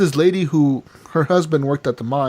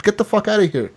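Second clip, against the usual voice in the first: higher-pitched retold angry order; mock angry dismissal; 6 semitones higher than usual. Arthur throwing Strauss out of camp, mock angry. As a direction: act out kicking someone out, mildly heated.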